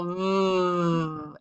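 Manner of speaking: a buzzing "Vroom" with teeth on the lip. Shown as a demonstration. Teacher showing kids the V buzz, buzzing "Vroom". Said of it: "you put your teeth on your lip like that and you go".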